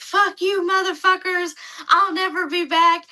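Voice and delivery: retold rant, lightly voiced; mock angry outburst. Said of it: "Ian's like".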